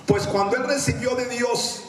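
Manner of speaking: high, slow, declaimed reading; scripture proclamation. Acted. Preacher reads Peter to congregation, high declamation.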